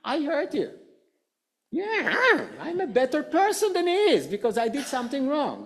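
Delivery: high-pitched, mock self-righteous gloating; feeling superior. Speaker voices the offended person relishing moral high ground, mock superior. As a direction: crow over being the better person. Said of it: "you feel superior over me".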